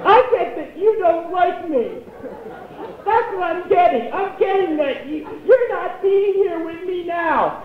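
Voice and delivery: high, drawn-out hammed-up wail; mock crying. Mom hurt by her son, high mock-tearful wail. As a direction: wail it like a weepy, wounded mother. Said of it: "And she starts crying".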